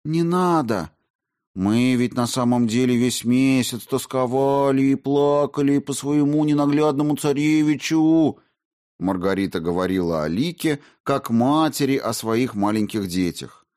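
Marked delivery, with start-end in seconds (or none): 0.0-8.4 mock sentimental. Margarita mock-tearful.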